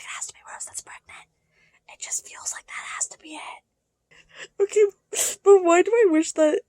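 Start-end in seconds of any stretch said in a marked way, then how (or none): 0.0-3.6 hushed, unvoiced whisper; whispered thought. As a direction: whisper it as a private hunch. Hushed inner thought while reading.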